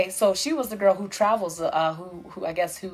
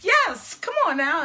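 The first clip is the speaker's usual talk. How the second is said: excited agreement; 11 semitones higher, slower than usual. Retold or imitated. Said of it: "And I was like".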